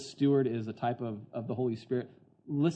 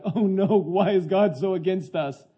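higher, louder mock panic; acted-out alarm, pitched up and loud; freaking out; 4 semitones higher, 6 dB louder than usual. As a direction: wail it like the panicked brothers. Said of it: "and it freaked them out".

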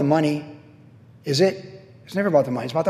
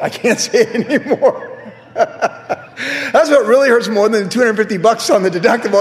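talking through laughter, louder; laughing; 6 semitones higher, 5 dB louder than usual. Real, not acted. Pastor joking with the congregation, laughing. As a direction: chuckle all the way through. Laughing about lost bragging rights.

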